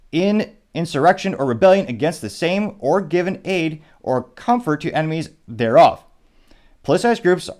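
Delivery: reading a statement aloud. Real, not acted. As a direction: read it out firmly, like a formal statement. Reads a candidate's written statement aloud.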